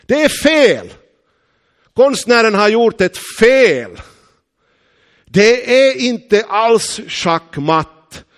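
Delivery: high, loud insistent shout, slowing down; shouting chess master. Retold or imitated. Chess master shouting the painting is wrong, loud. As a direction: call out the painter's mistake. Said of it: "sa han".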